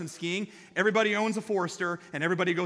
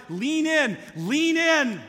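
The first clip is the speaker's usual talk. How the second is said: higher and louder, shouted instruction; yelling instruction; 8 semitones higher, 5 dB louder, much slower than usual. Retold or imitated. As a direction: yell it again beside a falling skier. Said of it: "constantly yell at me by my side".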